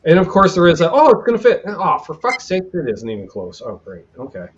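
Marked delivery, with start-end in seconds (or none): 0.9-1.6 high-pitched hopeful self-quote; mock optimism. Acting out his hope that it fits, mock optimism. 1.6-4.5 exasperation sinking low and soft; deflated exasperation. Retold or imitated. Repairer finds it isn't even close, deflated exasperation. Acting out finding it doesn't fit.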